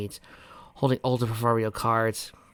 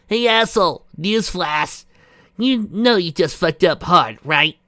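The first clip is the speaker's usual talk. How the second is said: defiant taunt; 8 semitones higher, 8 dB louder, slower than usual. Acted.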